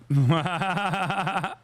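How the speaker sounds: real rapid-fire belly laugh; big laugh. Real, not acted. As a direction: burst out laughing in a long rattling run. Amused by his own jab.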